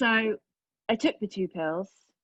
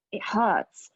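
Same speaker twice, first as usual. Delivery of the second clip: usual speaking voice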